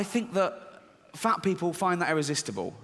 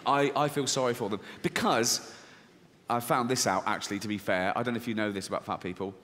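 ordinary delivery

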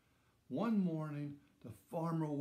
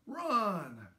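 higher, louder warning cry; urgent shout; 6 semitones higher, 5 dB louder than usual. A narrator's reading. Farmer warning his son as the ground smokes, urgent shout. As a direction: shout to get everyone away.

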